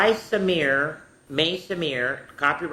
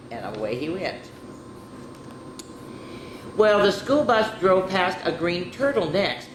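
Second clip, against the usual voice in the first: usual speaking voice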